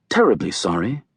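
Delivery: polite British apology; lightly British, courteous. A narrator's reading. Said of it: "Apparently the wind is British".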